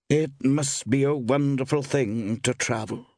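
high, slow, wistful woman; sighing. A narrator's reading. Woman shopkeeper envies a traveller, sighing. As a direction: lightly sigh it out, high and slow. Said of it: "sighed the woman".

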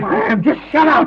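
loud, high-pitched shout; frayed, furious yell; angry outburst. Acted. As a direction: explode at a panicking mate. Snaps at panicking mate.

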